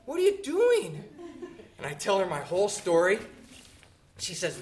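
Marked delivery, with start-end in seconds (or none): 0.0-1.0 put-on much higher, gentle old-lady voice; the sweet lady greeting the lost boy at her door. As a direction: coo it like a kind grandma.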